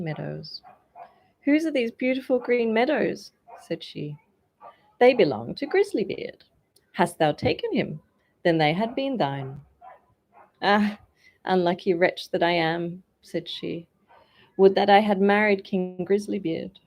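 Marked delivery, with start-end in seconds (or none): 1.4-3.3 higher, louder question; haughty princess. A narrator's reading. Princess asks. 5.0-9.6 teasing musician. A narrator's reading. Musician taunts. 10.6-16.8 louder, regretful cry; a touch of despair; mild lament. A narrator's reading. Princess laments.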